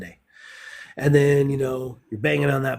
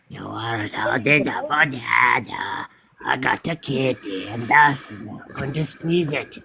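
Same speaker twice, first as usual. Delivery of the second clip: pet talk. Pretend. Playful talk to his cat.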